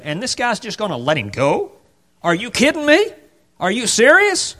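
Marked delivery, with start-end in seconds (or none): 2.2-4.5 high, rising disbelief; can't believe what he's hearing; mock incredulity, pitched up; incredulous. Disbelief at donkey instruction.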